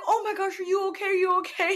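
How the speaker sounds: high-pitched flustered concern; imitated alarm. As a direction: fuss in a high voice, mock-alarmed. Acting out clerk alarmed by weeping.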